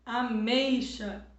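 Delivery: Ameixa stretched out slowly; teacher spelling out Ameixa slowly; slow example word. Shown as a demonstration. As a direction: draw out Ameixa so the ei stands out. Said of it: "Mamão".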